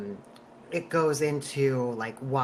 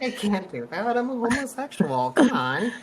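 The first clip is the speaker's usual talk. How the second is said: higher, campy mock refusal; campy retort; 6 semitones higher than usual. To a stranger asking for Chick-fil-A, camp mock refusal. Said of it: "I'm like".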